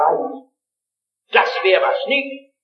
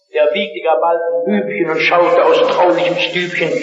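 poem recitation; 2 semitones lower than usual. Acted. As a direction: recite it like Eichendorff's lines. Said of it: "ein erschütterndes Lied von Eichendorff".

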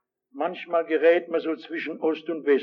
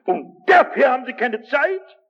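indignant challenge; 6 semitones higher, 6 dB louder than usual. Real, not acted. Rhetorical challenge.

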